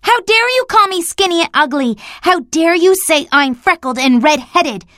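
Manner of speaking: high, loud indignant rant; furious child. A narrator's reading. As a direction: spit out every insult. Anne's furious outburst.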